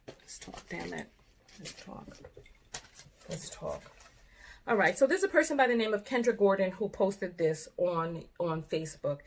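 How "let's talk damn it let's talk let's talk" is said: breathless whispered urging; exasperated whisper. Real, not acted. Host hushing herself to start the talk, whispering. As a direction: whisper it through clenched frustration.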